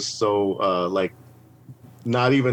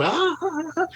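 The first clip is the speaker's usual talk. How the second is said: high ah ah ah laughing, much higher; imitated laughter; 3 dB quieter than usual. Everyone laughing at the spinning can, high mock laughs. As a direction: laugh like the crowd. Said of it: "everyone's laughing so loud".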